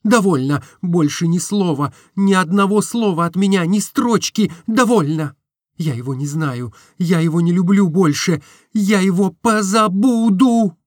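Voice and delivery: put-on wounded young woman refusing; Nastenka hurt. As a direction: push the memory away, wounded.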